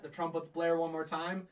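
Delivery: normal talking voice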